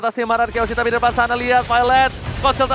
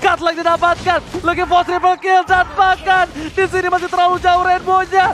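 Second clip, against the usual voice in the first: fighting game announcer; 7 semitones higher than usual. Acted. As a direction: call the teamfight at full hype. Esports caster hype call.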